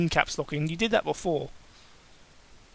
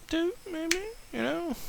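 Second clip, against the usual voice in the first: high shy; 13 semitones higher, 8 dB quieter than usual. Acted.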